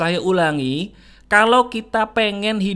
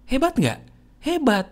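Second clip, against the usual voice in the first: ordinary talk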